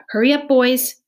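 put-on urgent call to the boys. A narrator's reading. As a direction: hurry them along from a distance. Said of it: "she called".

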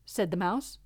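plain reading voice

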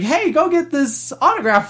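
high put-on cartoon voice; lightly hammed-up high character voice; Mickey. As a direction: chirp a request like a cartoon mascot. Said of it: "I go up to Mickey".

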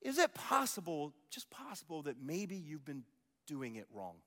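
quiet, drawn-down "possible"; hushed rhetorical question; soft probing question. Shown as a demonstration. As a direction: ask it gently. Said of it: "I'm just asking this question".

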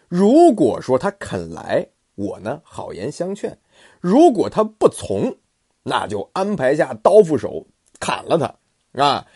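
稍高稍响，一句一顿地定计; 胸有成竹地摆出计策; 鲁肃献计. Acted. (鲁肃向主公献计，语气笃定)

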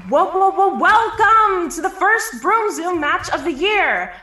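booming stadium announcer, echoing; magically amplified voice. Acted. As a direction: boom it out across the field. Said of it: "A booming voice echoes through the field".

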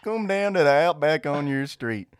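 loud mock Australian commercial; Australian accent. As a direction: belt the Aussie slogan like a radio spot. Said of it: "like, a country woman".

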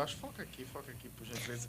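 real under-the-breath instruction; hushed aside. Real, not acted. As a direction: drop right down for a discreet request to the camera operator. Aside to the camera crew mid-sentence.

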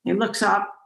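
lifted slightly on up; looking up. Retold or imitated. Telling the host about the shaman, voice rising on up. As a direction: voice drifts up with the glance.

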